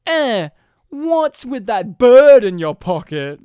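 loud, slow, deliberate quote; mock odd line reading. Podcaster quotes the son's baffling line, hammed-up. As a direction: draw it out loudly, word by word.

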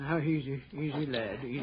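soft, hushed comfort to a dying lad; calm him down tenderly; gentle soothing. Acted. Doctor calms the dying boy.

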